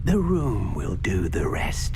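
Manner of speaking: low, slow whispered reassurance. Acted. House-elf whispering to the student as they imagine the room.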